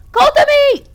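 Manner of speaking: high, loud calling out; desperate shout. Acted. Saturday calling for Kotomi in the burning house, shouting. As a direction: call her through the smoke.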